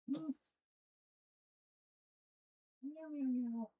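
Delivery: very high, cooing pet voice; baby talk to cat. Acted. Streamer cooing at her meowing cat in high baby talk.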